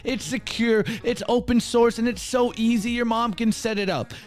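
bright, high, loud sponsor read over music. Acted. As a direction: sell it like a radio spot.

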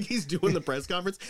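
normal talking voice

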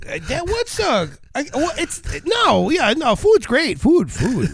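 high-pitched food excitement; high excited. Real, not acted. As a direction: gush about food with a squeal. Joking about loving food.

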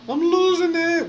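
sudden very high, slowed mock wail; comic falsetto meltdown on "losing". Real, not acted. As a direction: squeak it up high like you're cracking.

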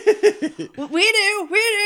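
high and loud, eager team; excited shout, mock enthusiastic. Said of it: "be like".

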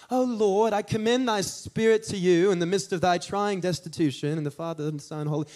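put-on grand old-English accent for a lofty prayer; mock KJV accent. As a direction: parody a pious King James prayer accent. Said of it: "they always have an accent".

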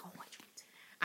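fast breathy whisper; witchy whisper. Acted. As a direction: rush it out in a whisper. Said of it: "then she'd be like".

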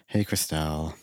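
low, soft mock-glum hello; retold dejected greeting. Worker greeting coworker after the finale, mock dejection. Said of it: "I was just like".

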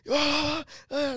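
high-pitched imitation of a yell; mock yelling. Son imitating dad yelling and swearing, high mock yell. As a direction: imitate dad's yelling, high but not full force. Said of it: "starts fucking yelling at the top of his lungs".

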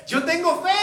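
father crying out his faith; shouting. Acted. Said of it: "el padre del muchacho gritó".

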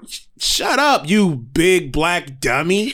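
slow, high mock auntie scold; aunt's put-down. Aunt scolding her nephew mid-argument, slow high mock scold. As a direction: drag out the put-down slowly. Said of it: "she was like".

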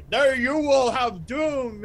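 slow, loud mock proclamation; doom pronouncement. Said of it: "the pronouncements of".

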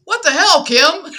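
high, loud mock outburst of surprise; startled brother. Brother reacts to her sudden demand, loud mock surprise.

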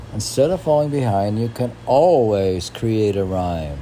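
poem reading. Acted. Reading a friend's posted poem aloud, loud declamatory recital. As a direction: declaim each line like a preacher's verse.

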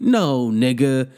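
usual speaking voice

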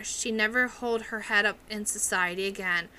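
plain reading voice

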